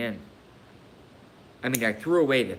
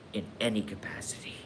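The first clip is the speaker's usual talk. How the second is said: whispered echo; 6 dB quieter, faster than usual. Shown as a demonstration.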